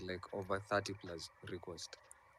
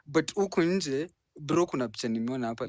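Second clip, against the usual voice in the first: much louder and higher, fired up; loud heated outburst; 5 semitones higher, 11 dB louder than usual. Real, not acted. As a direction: shout the point across to the crowd. Host urges cursing, loud and heated.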